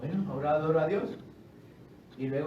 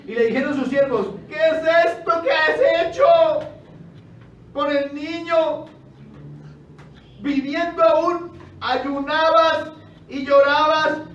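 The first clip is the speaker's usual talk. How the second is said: indignant reproach; 8 semitones higher, 9 dB louder than usual. Acted. Servants loudly reproach David for eating after the death. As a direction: demand it loudly, astonished at him.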